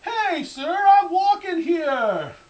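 very high, slow New York yell; New York accent. Pretend. Pedestrian cut off by a cabbie, mock New York shout. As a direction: holler at a driver in hammed-up New York. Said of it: "when a cabbie runs in front of me".

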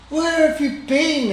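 a mother elephant's anxious exclamation; worried exclamation. A narrator's reading. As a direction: call out in relief and worry. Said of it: "exclaimed Mummy Heflapunt".

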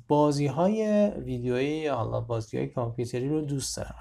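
ordinary talk, nothing marked

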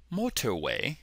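put-on British pronunciation; quiet amateur British imitation; British accent. Shown as a demonstration. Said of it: "give you my British accent".

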